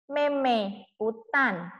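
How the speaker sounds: very slow, drawn-out words; slow model reading. Shown as a demonstration. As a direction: dictate it slowly to the class. Teacher builds sentence aloud. (membaca sangat pelan)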